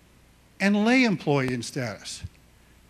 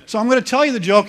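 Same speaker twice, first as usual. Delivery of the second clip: eager announcement; 5 semitones higher, 7 dB louder, faster than usual. Real, not acted.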